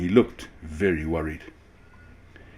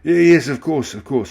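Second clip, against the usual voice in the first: flustered; 8 semitones higher, 10 dB louder than usual. A narrator's reading. Humbled ringmaster flusters.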